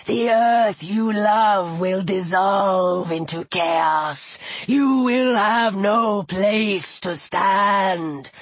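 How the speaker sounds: low, slow voice of doom; menacing serpent. A narrator's reading. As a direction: threaten her in stretched-out low words. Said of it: "the serpent said".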